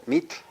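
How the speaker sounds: Meat ending in a hissed voiceless tl; lateral TL release. Shown as a demonstration. Teacher giving word for arrow, lateral TL release. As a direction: release the end of Meat at the tongue sides. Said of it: "Release the air at the sides".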